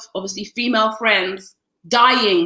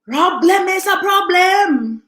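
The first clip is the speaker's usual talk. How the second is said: hyped; 6 semitones higher, 3 dB louder than usual. Real, not acted. Hyping a song title.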